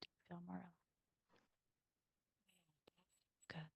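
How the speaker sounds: soft, hushed meditation voice; guided meditation. Acted. Guiding listeners to observe without chatter, hushed. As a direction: ease them gently inward.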